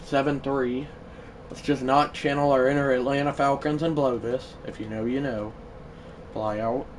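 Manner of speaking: ordinary talk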